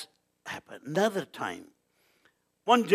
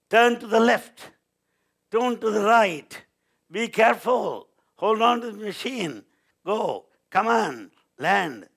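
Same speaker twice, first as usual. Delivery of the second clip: urgent raised, higher-pitched orders; loud commanding voice; 6 semitones higher, 6 dB louder than usual. Retold or imitated. Voice from ethereal space to a lost pilot, loud commands. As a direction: call out directions from the empty sky. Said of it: "He heard a loud voice".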